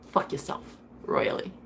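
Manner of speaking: a breathy whispered curse; hushed whispered send-off. Retold or imitated. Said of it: "I'm just the type to say".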